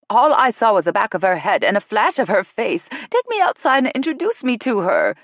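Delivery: excited mother pleading, pitch climbing; excitedly. Acted. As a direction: beg to be introduced, all worked up. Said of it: "Vivian said excitedly".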